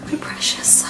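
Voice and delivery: whispered, cradling voice. Pretend. Vlogger petting her laptop, doting whisper. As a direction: hush it like a treasure.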